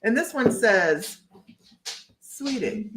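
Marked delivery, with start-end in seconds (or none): 2.2-2.9 a light Southern drawl on sweetie; Southern accent, softly condescending. Retold or imitated. Reading the Karen card in a light Southern accent. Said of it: "only done properly in a Southern accent".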